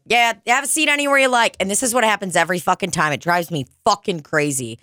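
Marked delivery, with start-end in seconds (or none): 0.1-1.6 higher, fast and upbeat host voice; perky hostess patter; cheery server greeting. Retold or imitated. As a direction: wave them in with a chirpy welcome. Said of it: "I'll be like".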